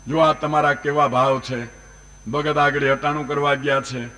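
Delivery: ordinary delivery, nothing marked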